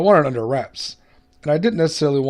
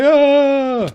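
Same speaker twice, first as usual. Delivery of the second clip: startled yelp; 13 semitones higher, 8 dB louder than usual. Real, not acted. Sudden startle mid-build.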